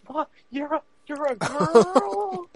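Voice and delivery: high, loud, drawn-out disbelief; hammed-up anime gasp of astonishment; mock shock. Guy discovering the hatted character is a girl, mock shocked.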